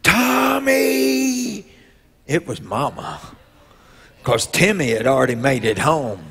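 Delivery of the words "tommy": long, high call of a name across the dark; mother searching at night for her lost son, calling out. Retold or imitated. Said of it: "I heard her voice".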